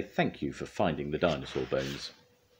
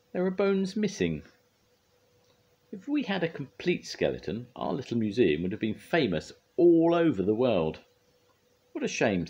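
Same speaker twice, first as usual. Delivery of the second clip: very high, fussy character voice; high, dismayed storybook figure; disappointed museum man; 9 semitones higher, 3 dB louder than usual. A narrator's reading. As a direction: lament it in a high-pitched voice. Mister Ellis laments missing bones.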